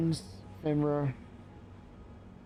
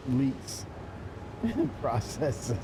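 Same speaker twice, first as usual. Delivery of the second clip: amused; 3 dB quieter, much slower than usual. Real, not acted. Amused by the announcement wording.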